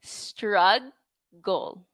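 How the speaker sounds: "Struggle" drawn out, g held long; teacher models "Struggle" on request; modelled struggle. Shown as a demonstration. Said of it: "please pronounce with me".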